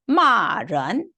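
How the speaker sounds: 마 falling from high, 른 rising; fourth then second tone. Shown as a demonstration. Teacher reading a word for students, falling 마, rising 른. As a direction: drop 마 hard, then lift 른. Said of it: "요렇게 읽어주면".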